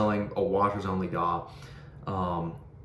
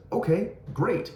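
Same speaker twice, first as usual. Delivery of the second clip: upbeat okay; 10 semitones higher, slower than usual. Retold or imitated. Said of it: "And I said".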